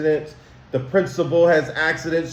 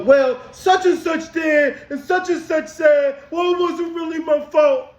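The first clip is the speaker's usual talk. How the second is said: much higher, whiny excuse-making; 12 semitones higher than usual. Pretend. Teacher mocking a kid who blames others, high mock whine. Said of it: "do you should go".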